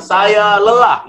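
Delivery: high, slow call of "lelah"; slow shouted call. Retold or imitated. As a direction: stretch it out across the cliff. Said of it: "datang ke tebing mengatakan". (teriakan pelan dan tinggi ke tebing)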